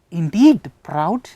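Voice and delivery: INDEED drawn out and loud; emphatic stress. Shown as a demonstration. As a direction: slowly punch "indeed proud" for emphasis. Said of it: "adding some more information with emphasis".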